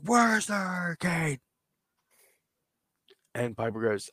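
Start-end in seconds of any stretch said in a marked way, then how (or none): 0.0-1.4 shrill-free mock yell, pitched way up; pitched-up retold yell; high-pitched screaming. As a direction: scream the question at him. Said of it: "He's still screaming".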